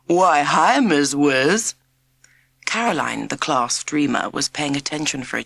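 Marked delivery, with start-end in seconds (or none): 0.1-1.7 louder, hammed-up American "Why, hi, Miss Wiz"; cheeky kid mocking with a put-on American drawl; silly American accent. A narrator's reading. Jack teasing the new teacher in a silly American accent. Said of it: "he said in a silly American accent".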